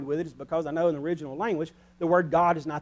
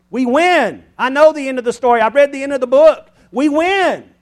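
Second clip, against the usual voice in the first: louder and higher to a ringing we win; triumphant preaching; 5 semitones higher, 13 dB louder than usual. Acted. Preacher telling the congregation we win, rising to a triumphant shout. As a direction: build it up to a shout of victory.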